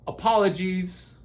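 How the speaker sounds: usual speaking voice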